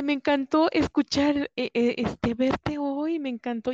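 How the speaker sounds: delighted gushing. Retold or imitated. Neighbor's delighted farewell quoted.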